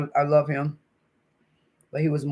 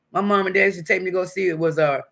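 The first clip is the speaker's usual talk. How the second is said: normal talking voice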